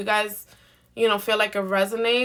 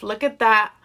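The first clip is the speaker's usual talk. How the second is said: ordinary talk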